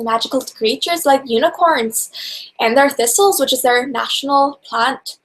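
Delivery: hammed-up amateur Scottish accent; shaky-quality mock Scottish accent, played for fun. Shown as a demonstration. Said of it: "right now, just stereotypical Scottish".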